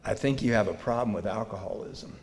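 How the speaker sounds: soft, low, calmly spoken words; very quiet and calm. Retold or imitated. Brother calmly confronting him over the phone, very quiet. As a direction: quietly tell your drunk brother the truth. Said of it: "he very quietly and very calmly".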